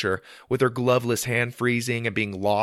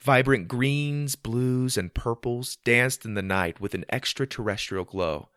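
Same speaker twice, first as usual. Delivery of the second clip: plain reading voice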